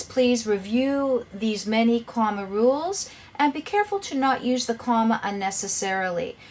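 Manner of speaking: ordinary talk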